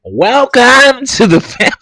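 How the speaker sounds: high, slow showy host welcome; mock talk show host welcoming the audience; reunion show host. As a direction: open the reunion like a grand hostess. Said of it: "this what gonna be like right here".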